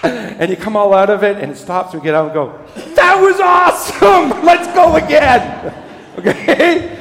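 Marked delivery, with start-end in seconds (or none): 2.9-7.0 high, loud and slow, laughing with glee; thrilled shout. Retold or imitated. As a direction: yell it like you just got off the coaster. Thrilled shout after coaster ride.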